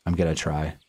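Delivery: usual speaking voice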